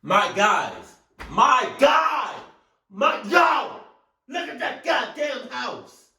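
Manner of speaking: high, loud, incredulous yells; real high-pitched outburst; shocked shouting. Real, not acted. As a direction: holler in disbelief at the sight. Shocked by burning house footage.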